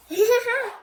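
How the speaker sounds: high, loud burst of laughter; bright loud giggle; delighted laugh. Real, not acted. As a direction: laugh at the pig. Laughing at pig picture.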